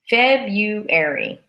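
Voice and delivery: February said as FEB-yoo-air-ee, two middle syllables squished, no R; slow careful Febuary, dropping the first R; Feb-yoo-ary. Shown as a demonstration. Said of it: "we say".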